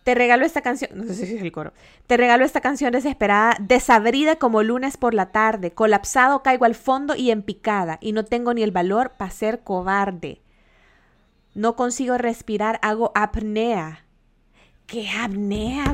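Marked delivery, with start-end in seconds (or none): 2.1-10.4 dramatic lyric reading. Shown as a demonstration. Streamer reads [name]'s chorus aloud, mock-dramatic. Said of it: "se lo voy a leer". 12.8-15.9 slow, drawn-out. Real, not acted. Drawn out puzzled word.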